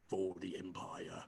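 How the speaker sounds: low, slow, soft toast; solemn toast. Acted. Dredger toasting the drinker set on the table, solemn and low.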